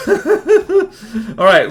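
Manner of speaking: laughing through the words. Real, not acted. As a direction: laugh as you say it. Laughing while speaking.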